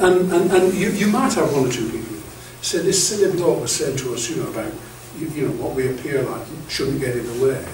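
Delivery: ordinary delivery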